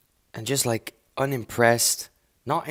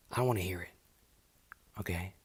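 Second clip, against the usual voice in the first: usual speaking voice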